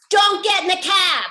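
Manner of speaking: loud shout of warning; yelling at the screen. Retold or imitated. Warning herself aloud in a horror movie, shouting. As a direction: yell it like at a horror movie heroine. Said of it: "I would yell at me on the screen".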